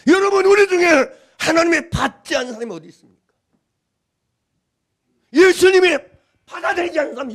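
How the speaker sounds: fervent sermon delivery; preaching. Acted. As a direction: preach it with conviction. Pastor preaching at full force.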